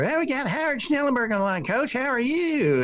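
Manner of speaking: high, fast, chipper radio voice; chipper host. Retold or imitated. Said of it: "Junior was all chipper".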